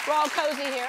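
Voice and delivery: high, loud bubbly announcement; excited announcer. Acted. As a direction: sell it with big excitement. Host announces offer, excited.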